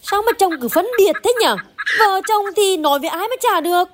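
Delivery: high, exasperated plea; wife protests to husband, voice pitched high; pleading protest. Acted. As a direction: push back at your stubborn husband. Wife pleads during quarrel.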